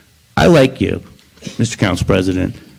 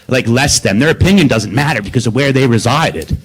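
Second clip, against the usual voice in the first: ordinary talk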